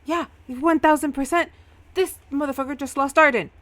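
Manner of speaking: high, loud, outraged accusation; angry yelling. Acted. [name] blaming Eamon to the newcomers, angry yelling. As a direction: shout the blame for all to hear. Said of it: "pointing and yelling".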